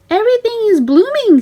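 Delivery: high proud delight; high Minnie voice. A narrator's reading. Minnie showing Mickey the blooming flowers, high and pleased. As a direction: show off the flowers happily.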